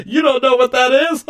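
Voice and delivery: snooty laughing put-on; mock a rich man's condescending laugh; hammed-up snobby chuckle; fake rich guy laugh.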